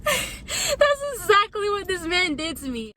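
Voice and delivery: half laughing, half wailing in disbelief; laugh-crying. Real, not acted. Telling viewers how he treated her, laugh-crying. Recalling being snapped at, laughing.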